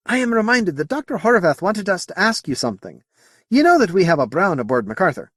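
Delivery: lightly voiced Scotsman bringing up a request; Scottish accent. Potter questions the Moti, light Scottish accent. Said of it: "it's the accent".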